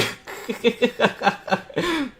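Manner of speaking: high bright burst of laughter; amused laugh. Real, not acted. Artist laughing at a too-big brush, high amused laugh. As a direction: laugh at your own mistake.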